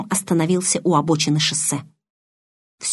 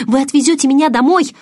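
высокая, громкая мольба; настойчиво упрашивай, голос вверх; 8 semitones higher, 6 dB louder than usual. A narrator's reading. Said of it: "взмолилась я". (умоляюще, высоко и громко)

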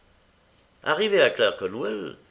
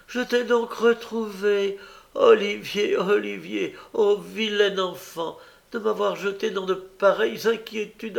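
fake wailing; 9 semitones higher than usual. A narrator's reading. Young woman pretends to find her lost brother, wailing high. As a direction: lay the grief on thick. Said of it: "se mit à pousser des cris et des gémissements".

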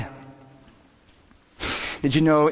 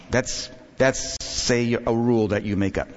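ordinary delivery

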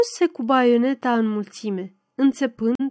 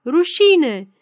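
loud, raised cry of reproach; howled jeer; 5 semitones higher, 6 dB louder than usual. A narrator's reading. Others in the crowd jeering the newcomer, howled jeer. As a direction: shout it like a heckler. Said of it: "alții urlau neîncetat".

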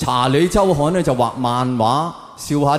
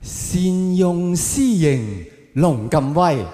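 reciting a couplet; 3 semitones higher, much slower than usual. Pretend. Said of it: "有幅對聯寫住".

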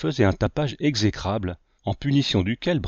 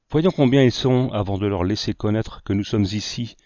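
ordinary delivery, nothing marked

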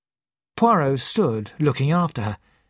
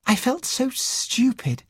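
high, slow, rueful confession; embarrassed admission; 11 semitones higher, slower than usual. A narrator's reading. Sarah remembers the meeting, embarrassed. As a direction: own up slowly in a high voice. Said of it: "she admitted".

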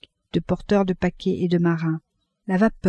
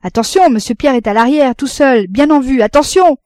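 raised, high lookout's warning; announcing; 5 semitones higher, 6 dB louder than usual. A narrator's reading. Captain with spyglass alerts the family, calling out. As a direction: call it out loudly, urgent to the last word. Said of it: "annonça".

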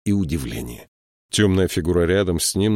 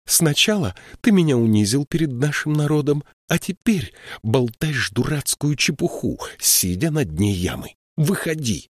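higher, exasperated scolding of a friend; 7 semitones higher than usual. A narrator's reading. Siskwi scolds Binabik sitting in the pit, exasperated. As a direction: scold him, half angry, half pleading.

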